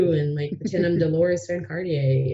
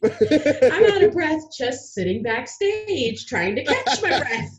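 higher, drawn-out laughing protest; laughing complaint; 7 semitones higher, 4 dB louder, slower than usual. Real, not acted. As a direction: complain through laughter, stretching it slowly.

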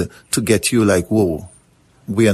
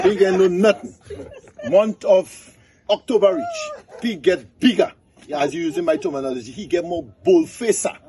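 high-pitched, animated storyteller; folk-tale storytelling; 9 semitones higher than usual. Acted. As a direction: tell a folk tale to a live crowd, pitched up. Tells a donkey folk tale.

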